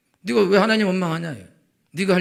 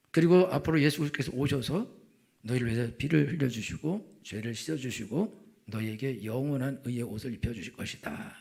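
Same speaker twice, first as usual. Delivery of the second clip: ordinary delivery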